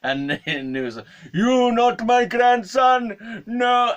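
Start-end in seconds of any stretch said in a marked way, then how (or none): 1.3-3.9 very high, drawn-out mock shout; really upset. Old man realizing he has no grandson, very upset. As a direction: imitate an old man wailing his rejection. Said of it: "he got really upset".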